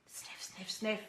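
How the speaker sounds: breathy dog sniffs; whispered sniffing. Acted. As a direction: sniff softly under your breath.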